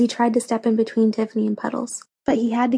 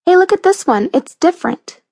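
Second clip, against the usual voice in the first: high, louder call for attention; excited discovery; 8 semitones higher, 5 dB louder than usual. A narrator's reading. Girl showing friends an odd comic, excited. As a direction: call the others over with interest.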